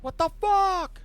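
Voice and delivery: high, loud, drawn-out outburst; a shocked crime boss; furious mobster seeing the wreck; angry mob boss. Acted. GM voices Sal.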